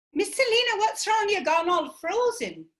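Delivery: put-on a fretful young helper checking on her mistress; young helper Tuli. Tuli worried because Selena has gone frozen, high and anxious.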